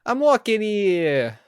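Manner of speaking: loud, high, drawn-out New York holler; slow, stretched New York yell; New York accent. Acted. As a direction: shout it like an indignant New Yorker. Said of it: "set the book in New York".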